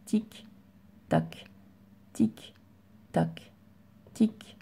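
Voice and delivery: soft, half-whispered tic tac like a clock; steady ticking. Shown as a demonstration. Teacher ticks like a clock for the pupil, soft. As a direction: tick like the second hand of a clock. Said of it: "mon doigt, il marche".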